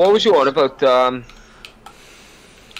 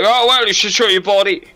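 higher, louder mimicked customer; put-on higher voice for a heckling subscriber; imagined subscriber; 7 semitones higher, 5 dB louder than usual. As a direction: lift the pitch to mimic a demanding fan. Acts out a complaining subscriber.

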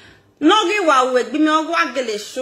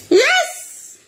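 emphatic yes; 4 dB louder than usual. Real, not acted. Emphatic agreement.

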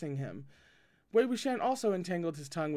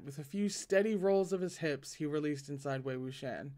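ordinary delivery

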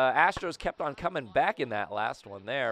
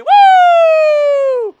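very high screamed whoop; long, loud celebratory woo; piercingly high cheer; victory whoop; 18 dB louder than usual. Real, not acted. Celebrates walk-off win.

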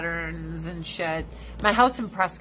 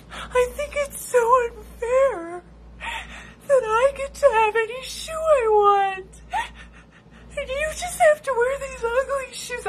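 imitating crying friend; 4 dB louder than usual. Friend on her period sobbing over shoe store unfairness. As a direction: blubber it out like a weepy friend. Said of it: "So this bitch starts crying".

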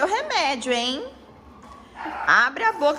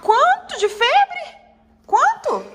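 high, slow and louder, playfully startled; surprised question; 8 semitones higher, 5 dB louder, slower than usual. Pretend. Mother asks the pretend doctor, mock surprised. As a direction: act amazed at the news.

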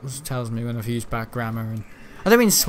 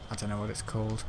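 much softer, lower, to himself; muttered repeat; 5 semitones lower, 12 dB quieter, faster than usual. Real, not acted. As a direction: mutter it under your breath.

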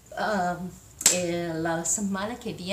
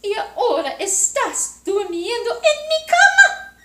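put-on angry baby bear; 12 semitones higher, 10 dB louder than usual. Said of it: "gritó bebé osito". (osito enojado gritando)

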